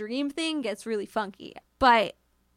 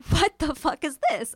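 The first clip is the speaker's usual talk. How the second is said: a frightened blurt, higher and changed voice; startled fright; 4 semitones higher than usual. Retold or imitated. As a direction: gasp it out in shock at the eyes. Sees eyes in the dark.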